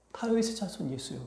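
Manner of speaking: hushed mock wail; weeping cry. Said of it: "엄청 울었다는 거예요".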